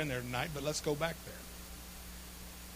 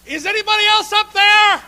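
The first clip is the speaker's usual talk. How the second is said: high, loud shout up the cliff; comic desperate shout; 15 dB louder than usual. Acted. Climber ignoring Jesus, shouting up for another rescuer. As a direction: holler for someone else instead.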